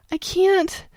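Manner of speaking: put-on high, loud tearful child; child crying she can't. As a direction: cry out in despair. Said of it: "Angelica cried".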